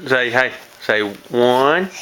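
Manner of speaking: slow drawn-out prompt; slow teaching. Shown as a demonstration. Parent teaching toddler to count, slow and drawn out. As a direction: spell it out slowly for the child. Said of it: "teaching toddler to count".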